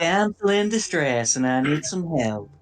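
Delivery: high, loud damsel in distress; southern belle. Acted. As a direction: play the helpless Southern damsel. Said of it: "Southern Belle accent?".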